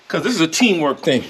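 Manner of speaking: ordinary delivery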